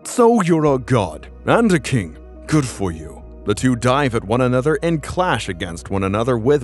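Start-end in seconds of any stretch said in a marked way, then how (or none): 0.0-3.2 mocking hero. Thor mocks the god.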